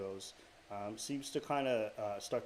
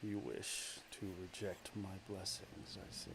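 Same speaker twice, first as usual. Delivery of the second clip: goddess voice; 4 dB louder than usual. Acted. Goddess of darkness coldly taunts the defiant hero, hushed. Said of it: "I gotta do this voice again".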